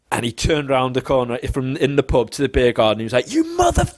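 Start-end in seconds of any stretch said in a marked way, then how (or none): 3.3-3.9 high-pitched mock rage, cut off; retold furious outburst. Man storming at his mates in the beer garden, mock furious. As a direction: launch into a swear and stop dead. Rages round corner, sees his mum.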